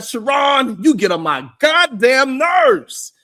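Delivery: high, loud, slow mocking yell; hammed-up furious hater; mock angry shout. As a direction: bellow it like an enraged commenter. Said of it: "they said".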